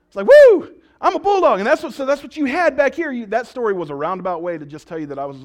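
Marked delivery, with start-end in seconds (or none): 0.3-1.6 loud high whoop, then the boast; hyped-up whoop. Retold or imitated. Pastor cheering himself on as bulldog, loud whoop. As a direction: pump yourself up like a fighter.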